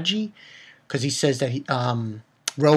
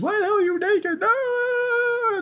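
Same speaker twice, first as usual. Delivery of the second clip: put-on high panicked girlish shriek, pitched way up; shrieking Lucy. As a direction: squeal it like a flustered anime heroine. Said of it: "She says".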